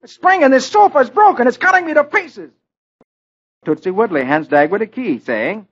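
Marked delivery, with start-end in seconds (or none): 0.0-2.5 high, loud pained yelps; yelling in pain. Acted. Dagwood on the broken sofa, yelling in pain. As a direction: cry out as a broken spring jabs you. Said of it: "He yells".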